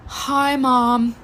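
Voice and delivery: hammed-up sickly greeting after heavy sighs; drawn-out mock-suffering hello; dramatically faking sick. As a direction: play poorly for sympathy, overdramatically. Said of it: "so dramatically, like".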